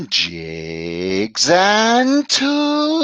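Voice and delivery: slow, drawn-out segment title like a show host; announcer read. Shown as a demonstration. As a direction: stretch out jigs and tools like a radio announcer. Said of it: "let me do it properly this time".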